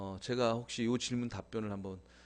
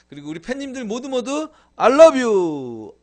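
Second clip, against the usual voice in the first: much higher, louder I love you; excited shout to fans; 10 semitones higher, 14 dB louder than usual. Real, not acted. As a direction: call it out to every fan at full joy.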